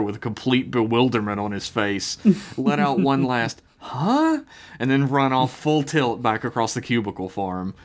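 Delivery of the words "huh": sharp high-pitched "huh?" of confusion; baffled yelp; bewildered huh. Acted. Bewildered character reaction.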